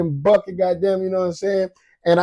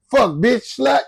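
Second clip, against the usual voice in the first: high, giggly swearing on request; laughing cussing; 7 semitones higher, slower than usual. Real, not acted. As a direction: cuss through a laugh. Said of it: "Cuss for me".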